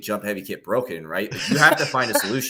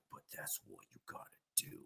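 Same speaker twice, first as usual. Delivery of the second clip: quick, barely audible whisper; inner voice; 11 semitones lower, 27 dB quieter, faster than usual. Acted. Competitive mindset insisting to the reluctant player, hushed quick whisper. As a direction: whisper it like a nagging thought. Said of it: "competitive mindset in my brain is gonna be like".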